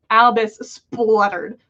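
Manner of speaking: ordinary delivery, nothing marked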